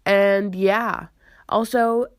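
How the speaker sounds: normal talking voice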